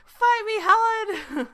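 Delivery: high laughing shout; loud. Pretend. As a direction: yell a joking challenge. Laughing mock challenge.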